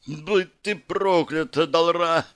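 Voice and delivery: high, slow curse cried out; pained cry. A narrator's reading. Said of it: "прокричала Янер".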